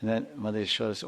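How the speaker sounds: ordinary talk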